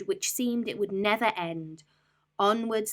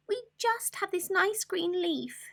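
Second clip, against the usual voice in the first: tiny ants; 13 semitones higher than usual. Acted. Ants answering the rat, tiny high chorus. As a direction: squeak it together small. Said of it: "the ants replied".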